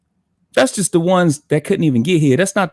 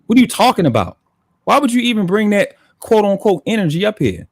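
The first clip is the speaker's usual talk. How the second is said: higher, louder challenge; real exasperation, demand an answer; irritated; 6 semitones higher, 3 dB louder than usual. Real, not acted. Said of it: "fucking irritated the shit out of me".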